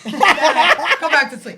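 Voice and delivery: loud, slow, high laughing line. Real, not acted. Guest laughing about never being up early.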